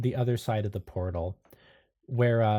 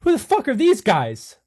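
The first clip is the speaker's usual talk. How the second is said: much higher, louder cursing yell; panicked shouting; 12 semitones higher, 8 dB louder than usual. Acted. Anderson finding himself back in the echo chamber, loud panicked yell. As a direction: scream it out in horror. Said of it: "Anderson is like".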